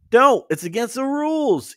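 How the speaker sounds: very high, alarmed protest; crying out. A narrator's reading. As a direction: cry it out high, starting loud. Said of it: "Iki cried".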